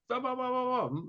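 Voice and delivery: high, soft mock yell; muffled yelling. Tow truck driver yelling through closed window, muffled. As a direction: imitate muffled yelling behind glass. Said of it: "he's yelling at me through his closed window".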